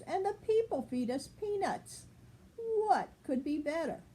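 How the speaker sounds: a hopeful duck selling the idea of this pond; pleased mother duck. Acted. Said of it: "said Missus Mallard".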